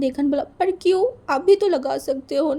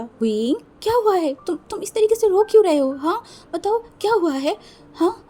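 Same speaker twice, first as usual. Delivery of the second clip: sudden, much louder, higher worried questions; alarmed big brother; 7 semitones higher, 5 dB louder than usual. Acted. Alpha startled awake by the crying boy, loud alarmed questions. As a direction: jolt awake and ask in alarm.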